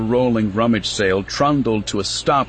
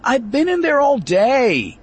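much higher whiny complaint; moaning; 4 dB louder than usual.

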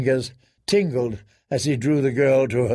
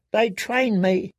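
high, drawn-out whimper; pitiful girlish whimper; 6 semitones higher, slower than usual. A narrator's reading. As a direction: whimper it slowly like a cowed girl. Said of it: "Jane whimpered".

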